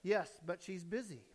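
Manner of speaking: soft, low, secretive answer; reply quietly as a little boy; hushed small voice; small child's voice. Acted. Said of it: "silent voice said".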